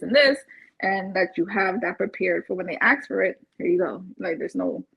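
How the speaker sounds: normal talking voice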